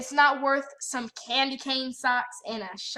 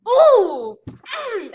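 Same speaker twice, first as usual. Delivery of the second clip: frustrated cry; 9 semitones higher, 11 dB louder than usual. Real, not acted. Angry outburst at sister.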